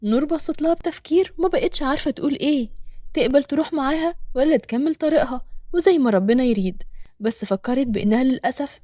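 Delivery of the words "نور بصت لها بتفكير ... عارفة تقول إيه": plain reading voice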